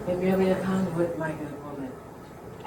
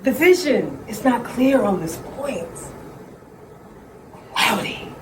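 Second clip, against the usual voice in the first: slow, hushed mystic seer voice; fortune teller; 2 semitones higher, slower than usual. Acted. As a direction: intone it like a séance medium.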